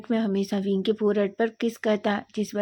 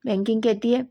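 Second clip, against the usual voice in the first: ordinary delivery, nothing marked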